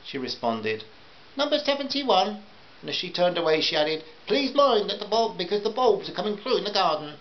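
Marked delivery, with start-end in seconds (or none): 1.4-2.4 high, put-on housewife voice; high-pitched customer reply. A narrator's reading. 4.3-7.1 high, put-on housewife voice; high-pitched fussy parting instruction. A narrator's reading. Voices the customer fussing.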